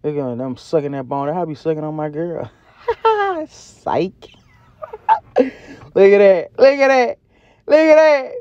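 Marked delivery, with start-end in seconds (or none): 2.9-3.4 a burst of high giggling at his own joke; high giggle. Real, not acted. Vlogger laughing at his own joke, high giggle. 4.8-5.3 a sharp squealing laugh; high squeal laugh. Real, not acted. Vlogger cracking up at the fly joke, squeal laugh. 5.4-8.3 each "Look at that" higher and louder; building excitement. Real, not acted. Vlogger showing off the meat to viewers, building excitement.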